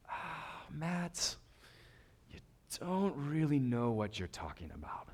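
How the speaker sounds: hushed, patient mock disappointment; weary letdown. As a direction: let them down quietly, as if weary. Jesus's weary answer to Matt, acted.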